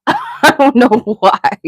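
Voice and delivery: giggling through the words, slowly; laughing. Real, not acted. Streamer confessing a pet peeve to chat, laughing. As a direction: laugh it off as you speak. Laughing at her own dislike.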